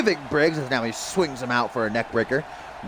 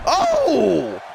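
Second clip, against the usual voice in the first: surprised; 13 semitones higher, 4 dB louder than usual. Real, not acted. Sudden ringside surprise.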